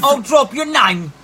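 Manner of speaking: loud, high mock Cockney; exaggerated Cockney holler; Cockney accent. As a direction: echo it in slow, hammed-up Cockney. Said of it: "some offensive Cockney accents".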